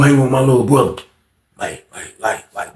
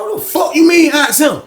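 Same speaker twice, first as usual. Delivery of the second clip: high-pitched indignant challenge; retold indignant retort; 12 semitones higher than usual. Inmate challenged by a rival, indignant retort. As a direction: act out an incredulous comeback, pitched high.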